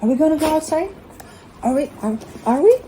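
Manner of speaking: high, bouncy teasing voice for the dog; high excited dog-talk. Pretend. Owner teasing her dog about going out, high excited voice. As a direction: wind the dog up with squeaky excitement. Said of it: "I'm messing with her".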